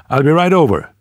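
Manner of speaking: high and loud, quick; eager promise. Acted. Rex promises Tanith he is coming, eager and loud. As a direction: snap into action.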